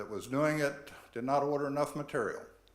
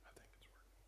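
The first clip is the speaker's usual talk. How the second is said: whispering; 29 dB quieter, much faster than usual. Real, not acted. Aside while setting up presentation.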